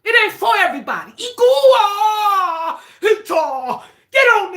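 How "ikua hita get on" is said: crying out strange names loudly; spirit call shout. Acted. Channeling spirit, calling out names.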